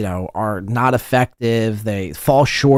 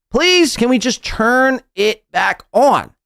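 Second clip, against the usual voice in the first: mocking whiny plea; 9 semitones higher, slower than usual. Mocking Aaron, pleading.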